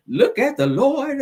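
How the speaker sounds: high, slow, drawn-out delight; laugh, then stretch it out high; playful exclamation. Pretend. Gloating over finding the mugshot, playful and high. Joking to herself while searching.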